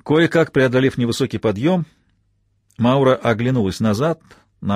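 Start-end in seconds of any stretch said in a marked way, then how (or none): none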